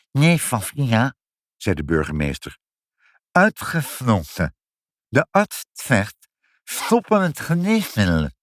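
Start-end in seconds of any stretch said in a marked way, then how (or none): 0.1-1.1 high, slow "Nee" with the name lisped; lisping refusal. Acted. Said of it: "Ik kan de F niet zeggen". 3.3-8.3 high, slow, "s" slurred in "Uitgesloten"; lisping refusal. Acted. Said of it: "Ik kan de F niet zeggen".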